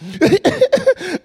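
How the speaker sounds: high-pitched mock child sobs; imitated loud crying. Baby brother after being shushed, imitated bawling. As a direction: burst into exaggerated little-kid bawling. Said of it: "They cry even louder".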